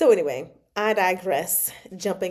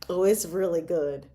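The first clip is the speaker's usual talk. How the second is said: normal talking voice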